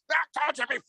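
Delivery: high-pitched cranky mock elder; exasperated old codger, hammed-up; nursing home old-timer. Co-host as aged friend repeating the argument, mock old-man exasperation. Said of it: "that'll be us at the, uh, nursing home".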